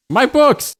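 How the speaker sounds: high, loud yelp of delight; squealing about my books; excited shout. Real, not acted. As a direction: blurt it out like you just spotted them. Sudden outburst about her books.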